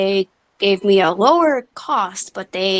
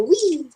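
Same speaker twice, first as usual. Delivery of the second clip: mock thrill whoop; 8 semitones higher than usual.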